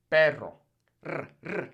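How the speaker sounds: "perro" with trilled rr, then "R" trilled alone; rolled R. Shown as a demonstration. Teacher models double R, rolled R. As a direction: roll it hard in "perro". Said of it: "You really gotta roll those R's".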